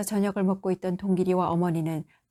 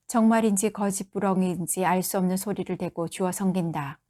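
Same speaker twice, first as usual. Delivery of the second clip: ordinary delivery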